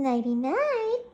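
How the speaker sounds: ordinary delivery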